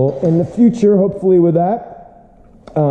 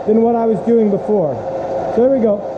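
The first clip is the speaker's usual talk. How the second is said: raised, high-pitched voice over the noise; shouting over machinery; 6 semitones higher than usual. Real, not acted. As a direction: call out above the running mill. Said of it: "I'll be yelling over the machine".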